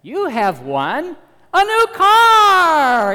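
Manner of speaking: booming, slow, very loud game-show announcer; hammed-up prize announcer, drawn out; big announcer voice. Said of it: "some big announcer voiceover voice says to the baby".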